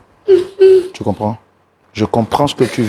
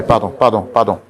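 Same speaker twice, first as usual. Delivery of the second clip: usual speaking voice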